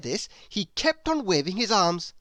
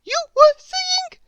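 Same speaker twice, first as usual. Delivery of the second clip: very high, loud bird call; cheeky call from the air; 9 dB louder than usual. A narrator's reading. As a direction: bird calling down to the pig. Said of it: "he called down to Oink".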